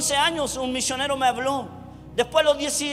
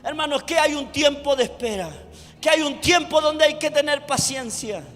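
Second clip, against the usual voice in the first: loud, raised preaching voice; preaching at full force; 4 semitones higher, 6 dB louder than usual. Acted. Preacher pressing his point on the congregation, loud preaching. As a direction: drive it home to the church.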